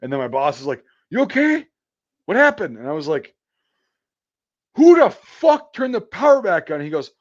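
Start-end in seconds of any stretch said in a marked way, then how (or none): 1.1-2.8 high, loud alarmed check-in; urgent, high-pitched shout; alarmed calling. Retold or imitated. Boss calls up after shock. 4.7-6.8 high, loud outraged demand; acting out a furious shout; angry yell. Shocked worker yells at boss.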